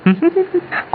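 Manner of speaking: high-pitched snickering laugh; sly snicker. Acted. Friend pranking a frightened wife, high snicker. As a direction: giggle at a successful scare.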